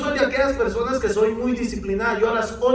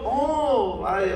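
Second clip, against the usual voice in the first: retold amazement. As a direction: react as if seeing something extraordinary. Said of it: "como que saber que hubiera visto". (asombro imitado, como maravillado)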